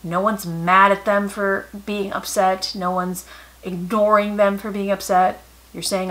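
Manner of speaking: usual speaking voice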